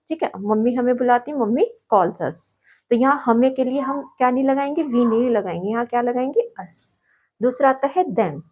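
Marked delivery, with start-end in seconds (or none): none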